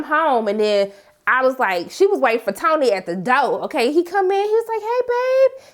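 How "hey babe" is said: put-on casual boyfriend greeting. Tony greeting Angela at door.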